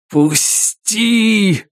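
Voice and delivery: high, anguished plea; pained cry. Acted. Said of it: "голос был наполнен такой глубокой болью".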